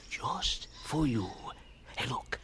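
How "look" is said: ordinary talk, nothing marked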